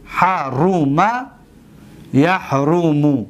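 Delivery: slow, syllable by syllable; measured teacher's demonstration, vowels held long; slow dictation. Shown as a demonstration. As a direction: stretch out each vowel for students to follow. Repeats the example slowly for class.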